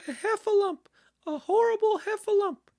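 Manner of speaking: very high, loud piglet squeal; panicked Piglet cry. A narrator's reading. Piglet spots the trapped creature, shrieking high in panic. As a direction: squeak out in terror for help. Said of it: "cried Piglet".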